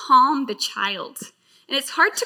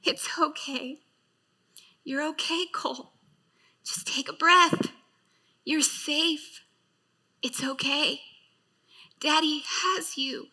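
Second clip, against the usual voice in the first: put-on soft whisper, calming a child; father whispering comfort to his gasping son; soothing whisper; 4 dB quieter than usual. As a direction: hush and reassure gently. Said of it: "hearing Jared say".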